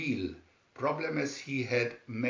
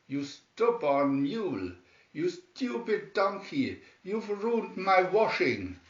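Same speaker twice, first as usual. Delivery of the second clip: higher, cross neighbor lady; scolding; 6 semitones higher, 3 dB louder than usual. A narrator's reading. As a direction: scold the mule for the washing. Angry neighbor scolds mule.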